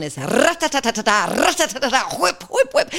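playful onomatopoeia of a verbal barrage; rapid-fire rat-a-tat. Shown as a demonstration. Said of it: "you don't stop me when I'm going".

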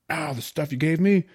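high, loud, grinning recognition; broad grin. A narrator's reading. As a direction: say it breezily through a widening grin. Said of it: "broadening his grin".

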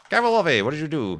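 loud, high call to a dog. Real, not acted. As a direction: call the dog's name across the field. Calling out to his dog.

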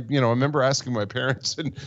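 ordinary talk

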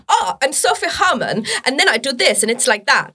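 put-on teasing take on her international accent; Sophie Herman impression. Comedian rinses a friend with a little act-out, high mock impression. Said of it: "you do like Sophie Herman".

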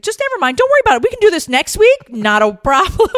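high, loud, over-bright imitation; hurried reassurance. Assistant to her crying boss, hurried high reassurance. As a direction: wave it all away in a high rush.

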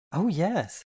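keen enthusiastic reply. A narrator's reading. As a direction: jump at the offer. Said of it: "she answered enthusiastically".

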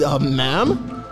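normal talking voice

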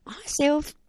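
high, loud eager hello; nervous greeting. Retold or imitated. Teen answering the head teacher's call, high and eager. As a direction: greet her boss with a jolt of nerves. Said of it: "And I was like".

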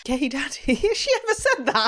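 very high laughing disbelief; shocked. Real, not acted. Friend shocked by a quote, squealing and laughing.

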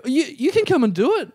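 high, stammering, nervous invitation; freaked out. Retold or imitated. Nervous man inviting a celebrity onto his podcast, freaked out. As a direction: offer it, then take it back awkwardly. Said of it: "I was just getting really freaked out".

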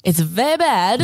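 put-on slow Borat-style accent. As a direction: say it like Borat, slowly. Repeating Borat-style joke.

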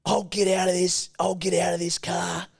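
high, strained mock threat; threatening older bloke. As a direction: act out an angry driver's threat. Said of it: "he was a bit of an older bloke".

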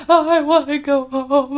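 high, loud, drawn-out mock wail; crying. Homesick girl wails to the counselor, mock crying. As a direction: imitate a kid bawling to go home. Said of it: "with her crying".

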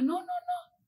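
high, small pleading "no, no, no"; frightened child. Retold or imitated. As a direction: imitate a scared little kid begging. Child begging not to be hit.

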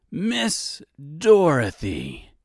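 drawn-out, high villain greeting; slow, high exclaim; exclaiming Reginald. A narrator's reading. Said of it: "Reginald exclaimed".

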